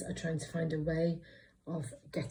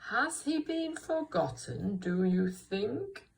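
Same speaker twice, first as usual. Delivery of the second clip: "think" stretched with a rising, wondering tune; questioning voice; 3 semitones higher, slower than usual. Shown as a demonstration. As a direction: draw it out and lift "think" into a question. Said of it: "say it in a questioning voice".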